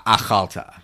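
achalta stressed on "chal", a-KHAL-ta; stress on middle syllable. Shown as a demonstration. Teacher confirms the modern Hebrew form, middle-syllable stress on achalta. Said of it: "With the accent on the middle syl-, the syllable there".

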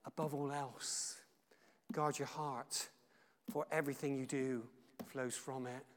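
hushed scripture recital; quiet recitation. Shown as a demonstration. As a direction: murmur the verse to yourself. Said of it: "and just declare it".

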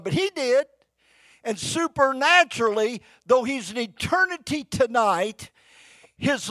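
loud, high preaching cry; preaching at full force. Acted. Preacher crying out to the church, loud and high. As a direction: preach it out to the congregation.

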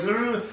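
lightly hammed-up growl; pirate growl. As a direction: snarl like a walrus pirate. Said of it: "He's like".

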